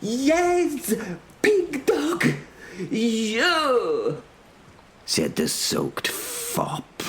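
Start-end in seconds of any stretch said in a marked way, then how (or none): 0.0-4.2 very high outraged comic voice; irate foreign herald. Acted. Herald singles out the knight, high-pitched outrage. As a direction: fling the insult in a high foreign accent.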